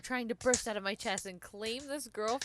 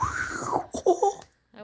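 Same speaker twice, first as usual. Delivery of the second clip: laughing; 10 dB louder than usual. Real, not acted. Laughing while reading narration.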